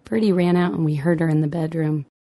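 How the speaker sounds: ordinary delivery, nothing marked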